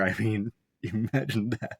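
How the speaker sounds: slow, amused words broken by laughter; laughing. Real, not acted. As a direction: chuckle while you say it. Amused by the shaving prank.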